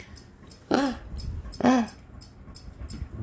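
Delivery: big breathy pretend gasp; mock gasp. Owner fakes shock at her snake, a hammed-up gasp.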